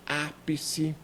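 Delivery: "ápice" slow, with a long initial Á; antepenultimate stress. Shown as a demonstration. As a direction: stretch the Á of "ápice". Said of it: "As proparoxítonas são ápice". ("ápice" com o Á tônico alongado)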